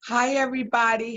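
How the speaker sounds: ordinary talk, nothing marked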